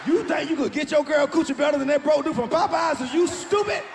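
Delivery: loud, high taunt at the cheater; mock shouting. Comic taunting a cheating boyfriend, loud and mocking. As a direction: yell it like you can't believe him.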